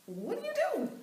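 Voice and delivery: very high-pitched "what do you do"; squeaky bewildered question. Retold or imitated. A confused person reading her cluttered card, squeaking. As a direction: ask like a baffled reader.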